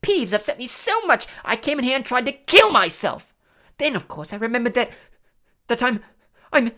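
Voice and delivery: high, loud theatrical lament; dramatic. A narrator's reading. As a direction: build a grand tale of woe, then falter. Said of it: "said Myrtle dramatically".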